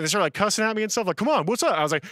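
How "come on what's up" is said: loud, high goading challenge; goading jeer; taunting. Retold or imitated. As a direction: egg him on to fight. Friends cussing and taunting.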